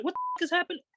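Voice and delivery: very high, aghast squeak; retold bewilderment. Watching punches in the pit, aghast squeak. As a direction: squeak it in mock disbelief.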